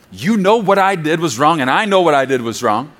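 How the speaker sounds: ordinary talk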